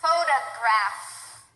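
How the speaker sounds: each word stretched out slowly for the learner; slow drill words. Shown as a demonstration. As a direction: say every F-sound word slowly and clearly. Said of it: "We're gonna do some examples with it".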